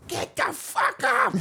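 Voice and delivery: high, drawn-out fan yell; imitated yelling. Fans heckling kneeling players during anthem, mock yelling. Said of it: "what they were yelling".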